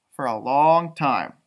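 ordinary delivery, nothing marked

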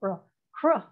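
cr said as two blended sounds, k then r, with no vowel; sounding out cr. Shown as a demonstration. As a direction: isolate the cluster cr. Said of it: "that combination of two phonemes".

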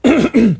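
hard throat clearing after choking. Real, not acted. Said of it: "perdón, me atraganté".

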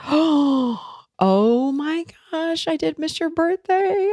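high, drawn-out gasped "oh my gosh"; shocked realisation. Retold or imitated. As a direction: act out horrified realisation, pitch climbing high. Acting out shocked realisation of mistake.

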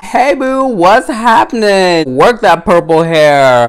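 high, playful greeting to a doll; cooing at the doll. Real, not acted. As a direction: greet him like a friend you missed. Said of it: "talking to him like he's even here".

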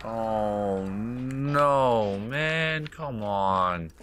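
drawn-out wail, rising then dropping; dismayed protest. Real, not acted. Player let down by the game, dismayed protest. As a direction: moan in stretched-out protest.

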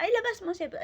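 a mother snapping at her son; retold shouting. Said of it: "talaga nagsigawan sila".

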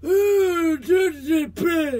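mock dark wizard straining; hammed-up villain grunting. Acting out Voldemort's effort.

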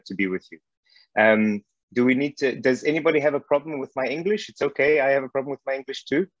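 normal talking voice

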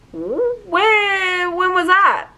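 high-pitched, stretched-out mock bewilderment; baffled disbelief. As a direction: re-enact being totally thrown, voice way up. Baffled reply to dad, retold.

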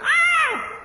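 high piercing shriek; a sudden shocked cry; startled shout. Retold or imitated. Daughter shrieking as she sees her skin healed. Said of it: "she went".

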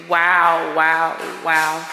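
ordinary delivery

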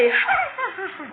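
sudden high laugh, falling off; quick high cackle that drops away; high burst of laughter. Real, not acted. Laughs at own claim.

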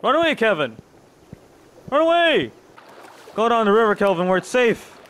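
loud, high-pitched call. Real, not acted. Player urging companion to run away, calling out. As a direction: shout a warning, pitch jumping up.

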